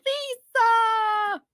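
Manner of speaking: high drawn-out call. Real, not acted. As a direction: shout the name out long. Calling out suddenly.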